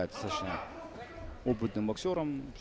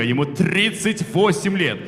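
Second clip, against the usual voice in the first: boxing ring announcer; 8 semitones higher, 10 dB louder, slower than usual. Acted. Announcer reading age.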